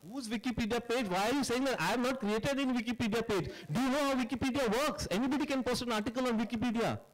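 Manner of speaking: agitated rebuttal. Real, not acted. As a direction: defend yourself, irritated and incredulous. Director rebuts Wikipedia claim.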